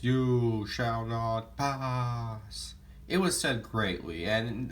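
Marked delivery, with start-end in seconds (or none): 0.0-2.7 very slow, drawn-out wizard command; Gandalf impression. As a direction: stretch every word like the wizard on the bridge. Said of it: "as he delivers the famous".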